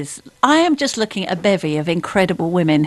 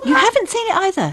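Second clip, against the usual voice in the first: surprised; 10 semitones higher than usual. Real, not acted. Mock shocked disbelief.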